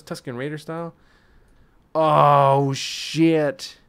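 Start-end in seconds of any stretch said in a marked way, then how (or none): 1.9-3.8 excited. Real, not acted. Reacts to new skin.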